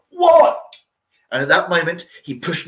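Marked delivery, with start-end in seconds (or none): 0.1-0.6 high loud startled what; surprised yelp, much higher. Acted. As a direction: snap round in surprise, very high. Turning round, startled.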